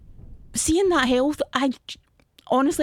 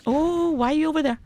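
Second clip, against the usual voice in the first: high, alarmed protest; dismayed and raised in pitch; worried protest; 7 semitones higher than usual. Real, not acted. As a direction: object anxiously, pitch climbing into rapid no-no-nos. Enemy in the wrong place.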